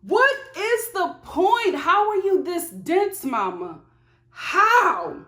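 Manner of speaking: high, incredulous exasperation; mock disbelieving scold to the mother; exasperated. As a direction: pitch it up in disbelief at the mom. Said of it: "So I'm like".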